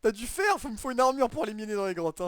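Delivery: high, loud outraged yell. Real, not acted. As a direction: shout in indignation. Outraged at skeleton attack.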